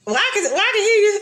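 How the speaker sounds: high mock-stern call-out; scolding mama. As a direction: tease her like a mother who caught her. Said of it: "put her hand on her hip".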